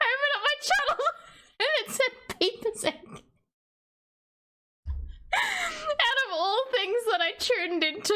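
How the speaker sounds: high, laughing disbelief; giggling through the words. Real, not acted. Laughing retelling.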